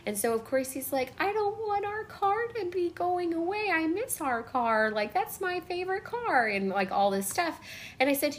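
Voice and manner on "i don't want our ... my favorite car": put-on higher, softer child's voice pleading over the car; upset little boy. Young son begging to keep the old car, whiny child voice. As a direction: play a small boy sad to lose the family car.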